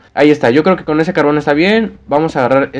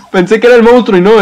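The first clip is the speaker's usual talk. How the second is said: relieved laughter; 5 semitones higher, 4 dB louder than usual. Real, not acted. Relief, tree not the monster.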